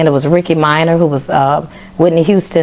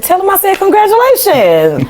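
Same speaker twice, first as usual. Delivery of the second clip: mock gracious. Congratulating the chosen singer, mock gracious. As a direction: gush it falsely sweet.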